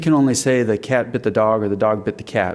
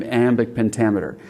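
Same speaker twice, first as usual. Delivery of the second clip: ordinary talk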